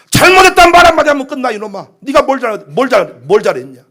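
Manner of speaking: loud, rapid telling-off; scolding mother. Acted. Mother pushes child to apologize to father, loud scolding. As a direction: nag the child hard to apologize. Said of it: "등짝을 때리면서".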